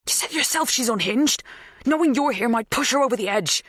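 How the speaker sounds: high-pitched, agitated remonstrance; heated arguing. A narrator's reading. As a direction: argue urgently to hold them back. Heated argument with Helen.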